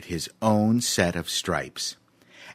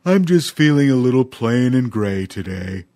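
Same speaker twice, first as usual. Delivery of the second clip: a mopey elephant, louder; gloomy young elephant; 4 semitones higher, 6 dB louder than usual. A narrator's reading. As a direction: sound plain and gray. Voices Dumphy the elephant.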